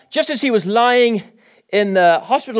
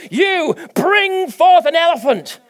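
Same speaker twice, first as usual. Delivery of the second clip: slow, loud, higher divine command; booming God command; 10 semitones higher, 4 dB louder, slower than usual. Acted. Preacher voicing God calling forth an elephant, booming slow command. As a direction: call the elephant into being.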